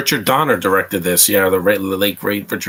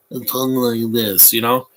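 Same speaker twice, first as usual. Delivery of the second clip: slow, weird put-on Tyson-like voice; [name] movie voice. Movie fan showing [name]'s weird choice, slow put-on voice. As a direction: drag it out in a mocking imitation. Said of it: "[name] doesn't talk like this".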